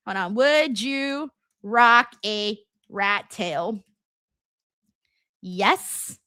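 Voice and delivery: ordinary talk, nothing marked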